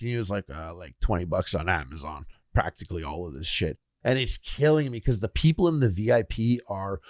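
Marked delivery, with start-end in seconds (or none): 0.5-3.8 low mumbly buddy voice. Retold or imitated. Friend casually explaining his cheap VIP setup, low and soft. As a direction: lower and softer, a lightly voiced laid-back buddy.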